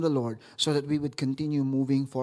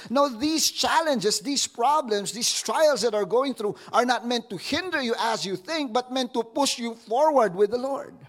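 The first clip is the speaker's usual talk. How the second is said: lightly voiced Paul; voicing Paul; 7 semitones higher than usual. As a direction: declare it like the apostle. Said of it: "he's saying".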